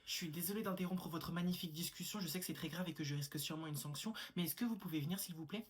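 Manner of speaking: soft, overly polite request; polite pupil voice. Acted. Pupil politely interrupting the teacher, soft. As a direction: ask sweetly and carefully.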